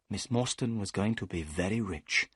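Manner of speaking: ordinary delivery, nothing marked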